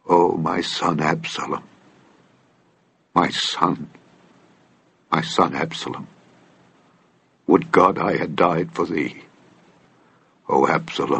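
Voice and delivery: low, hushed grief with long pauses; weeping lament. A narrator's reading. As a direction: mourn softly, as a bereaved father. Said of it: "and wept".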